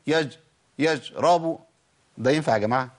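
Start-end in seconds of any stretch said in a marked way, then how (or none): 0.1-1.6 ش of يشربون said as a j; wrong j sound in place of ش in يجربوا; shin turned jim. Shown as a demonstration. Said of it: "دخلت على مخرج الجيم".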